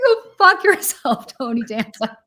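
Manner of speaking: loud yell breaking into laughter; retelling her own street rant, yelling through laughter; laughing yell. Retold or imitated. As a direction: shout it while cracking up. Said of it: "I go crazy".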